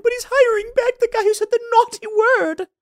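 high, mocking whine of an offended critic; mocking whiny woke voice. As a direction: parody them as shrill crybabies. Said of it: "all the woke people are start crying".

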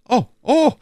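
high, loud knowing oh oh; suddenly seeing through it; a loud, high dawning realization; surprised oh. Acted. D'Artagnan grasps the intrigue.

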